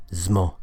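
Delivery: "zmo" starting on a buzzed Z; isolated assimilated syllable. Shown as a demonstration. Teacher isolating the second part, "zmo" with voiced Z. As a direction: buzz the Z straight into M for "zmo". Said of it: "pronounced with assimilation like this".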